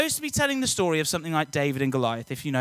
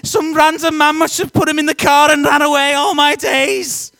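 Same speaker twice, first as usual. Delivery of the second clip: put-on high, loud, panicked Liverpudlian accent; frantic Liverpudlian mum calling for her missing son; 7 semitones higher, 9 dB louder than usual. Mum driving round Rugby terrified, loud panicked Liverpudlian voice. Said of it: "She's got a Liverpudlian accent".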